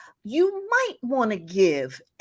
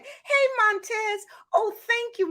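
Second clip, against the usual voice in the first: delighted greeting. Real, not acted. Host to viewer joining the live, delighted. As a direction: greet a friend who just showed up, way up high.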